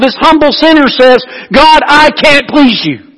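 high, loud, driving sermon build-up; preaching at full force. Acted. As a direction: preach it hard and rising, pressing every line.

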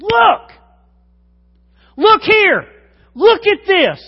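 loud, high exasperated call; shouted look. Shown as a demonstration. Preacher acting out a frustrated person yelling at someone. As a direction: demand attention, almost shouting. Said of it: "And finally you said".

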